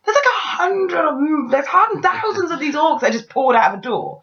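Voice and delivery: high, incredulous protest; mock-outraged viewer; exasperated disbelief. Exasperated retelling of own reaction.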